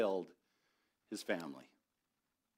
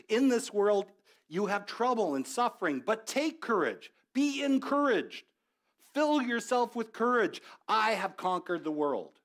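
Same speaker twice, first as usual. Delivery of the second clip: impassioned preaching; 9 semitones higher, 6 dB louder than usual. Acted. Preacher voicing Jesus' words.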